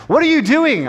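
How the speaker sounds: hammed-up British officer, swooping up high; botched British accent. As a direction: demand it in a badly faked British accent. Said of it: "I'm not gonna try to do any kind of British accent here".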